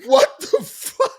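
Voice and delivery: high, drawn-out incredulous laugh-talk; laughing disbelief. Retold or imitated. Viewer stunned by the twist, laughing in disbelief. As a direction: squeal it through laughter, stretching each word.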